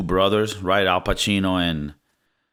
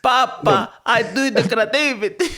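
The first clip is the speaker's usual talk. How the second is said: very high, whiny-son mimicry; hammed-up plea to papa, pitched way up; eccentric son; 14 semitones higher than usual. Playing the son pleading with his father, high mock voice.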